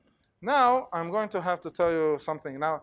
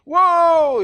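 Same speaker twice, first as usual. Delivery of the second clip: loud, high stadium cheer; big high shout of a cheering fan; cheering whoop; 12 semitones higher, 13 dB louder than usual. Retold or imitated. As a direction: whoop like a fan starting the wave. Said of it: "somebody who wants to cheer his crowd goes".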